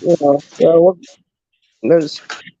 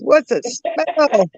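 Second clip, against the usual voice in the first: put-on higher, very slow child's question; little nephew voice; 6 semitones higher, much slower than usual. Nephew asking about the bad smell, puzzled child voice. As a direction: ask it like a puzzled little boy. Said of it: "He says".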